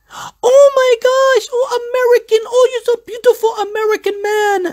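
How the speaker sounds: very high, squealed falsetto impression of a smitten girl; mock a gushing Swedish girl, loud and squeaky; gushing drunk Swedish girl. Tipsy Swedish girl fawning over an American man, high mock squeal. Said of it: "deep in the Swedish accent".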